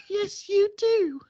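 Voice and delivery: high, slow mock British woman; woman's British accent. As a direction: purr it back like a smitten English lady. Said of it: "I'm gonna do a man and a woman accent".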